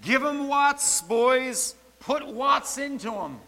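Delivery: high, loud, slow rallying shout; shouted battle cry. Retold or imitated. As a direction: roar it to soldiers mid-fight. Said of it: "Cadwell shouted".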